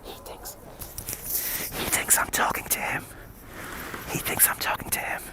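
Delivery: hushed, voiceless whisper; whispered aside. Real, not acted. Streamer to viewers about a man who thinks he's being addressed, whispered aside. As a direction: whisper it so he can't hear.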